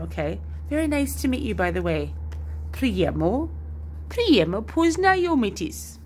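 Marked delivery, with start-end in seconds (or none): 2.7-6.0 "priemno poznayomitis" higher and slower, Russian-tinged; trying a Russian accent. Shown as a demonstration. Vlogger tries the phrase with a Russian accent. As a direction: stretch out "priemno" with a Russian lilt. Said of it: "I'll try it one more time".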